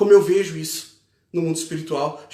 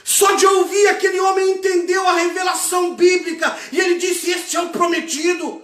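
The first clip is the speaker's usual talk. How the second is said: high, impassioned sermon shout; loud, driving revival preacher delivery; full-force preaching; 12 semitones higher, 5 dB louder than usual. Real, not acted. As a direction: preach urgently at the top of your register.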